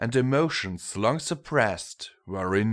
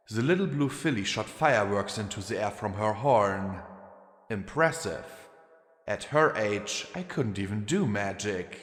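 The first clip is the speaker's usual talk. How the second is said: ordinary delivery, nothing marked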